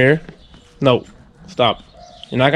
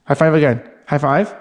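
normal talking voice

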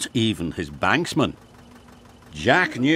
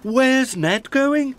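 high, slow character question; puzzled question; 14 semitones higher, 3 dB louder, slower than usual. A narrator's reading. Jack seeing Ned leave, puzzled question. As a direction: ask it slowly, pitched way up.

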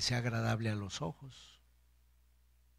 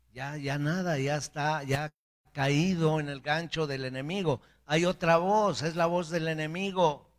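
building to a loud, higher preacher's cry; forceful preaching; 3 semitones higher, 5 dB louder than usual. Real, not acted. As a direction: drive the warning home to the congregation. Preaching rises to full force.

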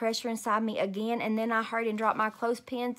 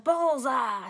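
a mild triumphant yell of Bullseye; lightly voiced happy shout; 3 semitones higher, 3 dB louder than usual. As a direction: call out Bullseye like a cheer. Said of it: "she yelled".

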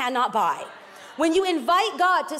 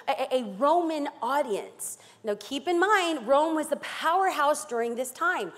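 ordinary talk, nothing marked

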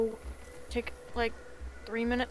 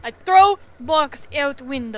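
loud furious broken English; angry shout; 2 semitones higher, 10 dB louder than usual. Pretend. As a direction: yell it in a rage. Customer rages at bad news.

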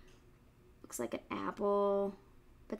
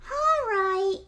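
piglet voice; 11 semitones higher, 7 dB louder than usual. Acted. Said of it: "said the piglet".

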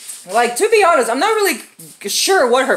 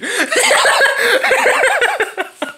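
high cackle. Real, not acted. Laughs at his own Hulk bit.

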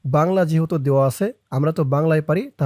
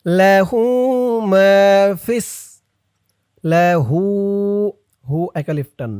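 tajweed recitation; 3 semitones higher, 3 dB louder than usual. Shown as a demonstration. Recitation with corrections.

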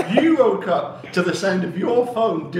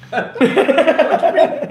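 asking through a real laugh, pitch jumping higher; 8 semitones higher, 4 dB louder, much slower than usual. Real, not acted. Teased about day drinking, she asks through a laugh. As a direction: laugh out the question, drawn out slowly.

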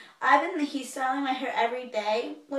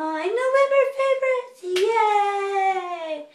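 excited cheer; 8 semitones higher, 8 dB louder, much slower than usual. Real, not acted. Excited intro of video topic.

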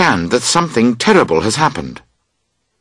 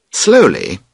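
ordinary delivery, nothing marked

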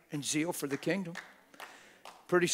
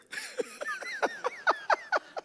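high squealing laugh. Real, not acted. Laughing about counseling room.

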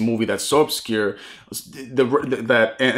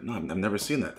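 much softer, meek mumble; small, embarrassed, much softer reply; sheepish admission; 4 semitones lower, 11 dB quieter, faster than usual. Retold or imitated. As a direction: quietly own up to never seeing it. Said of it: "I was just like".

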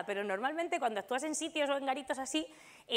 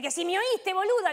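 raised, louder Argentine insistence; annoyed Argentine accent, louder; 5 semitones higher, 4 dB louder than usual. Retold or imitated. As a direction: push the point in an Argentine accent. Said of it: "el acento argentino".

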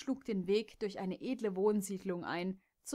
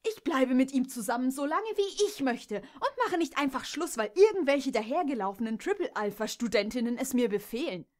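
loud, higher, indignant tirade; angry defiance; 4 semitones higher, 6 dB louder than usual. Acted. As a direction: refuse them with fierce pride. Jess defies the sorority mares. (Jess weist die Stuten wütend und laut zurück)

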